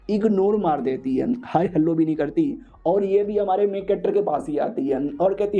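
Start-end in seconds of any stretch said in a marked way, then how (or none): none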